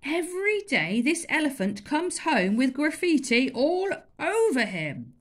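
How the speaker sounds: shouting. Acted. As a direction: shout in frustration. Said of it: "shouted the elephant master".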